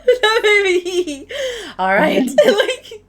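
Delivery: words breaking up in loud high laughter; laughing while talking. Real, not acted. Game master laughing through words at the player's joke. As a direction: talk through the giggles.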